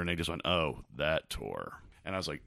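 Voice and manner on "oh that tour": weary groan. Retold or imitated. Band recalls a miserable tour.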